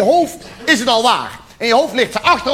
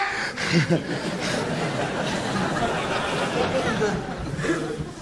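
breathy laugh; 9 dB quieter than usual. Real, not acted. Audience-facing laugh.

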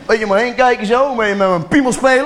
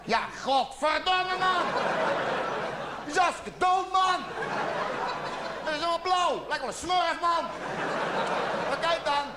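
put-on high, shouting outrage at the babysitter; furious parent; 6 semitones higher, 13 dB quieter than usual. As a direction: yell it at her in disbelief. Parent finds dead baby, yelling. (woedende ouder die tegen de oppas schreeuwt)

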